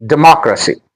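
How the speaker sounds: "Democracy" with MOC made prominent; stress on second syllable. Shown as a demonstration. Teacher modelling word stress for learners, "Democracy" stressed de-MOC-racy. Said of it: "The stress is on M".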